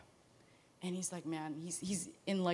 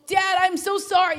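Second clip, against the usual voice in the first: higher and louder, pleading; begging; 9 semitones higher, 10 dB louder than usual. Retold or imitated. Returning son begging his father's forgiveness, loud and high. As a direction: throw yourself on his mercy. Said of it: "begging for forgiveness".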